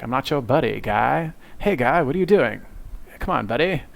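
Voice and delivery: cartoon Canadian accent, swooping pitch; mock South Park Canadian accent; Terrence and Phillip. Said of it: "they make fun of Canadians and they're like".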